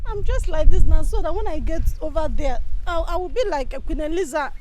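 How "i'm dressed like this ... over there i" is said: loud insistent protest; loud defence. Acted. Recruit loudly defending his winter outfit. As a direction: push back hard.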